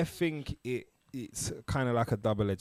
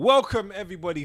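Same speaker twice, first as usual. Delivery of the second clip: energetic welcome; 8 semitones higher, 6 dB louder, faster than usual. Real, not acted. Podcast intro greeting.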